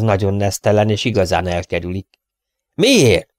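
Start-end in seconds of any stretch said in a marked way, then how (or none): 2.7-3.3 sharp, high, louder offended outburst; indignant snort. A narrator's reading. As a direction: snap the question back, affronted. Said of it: "Horkant föl Barker". (felhorkanó, sértett kérdés)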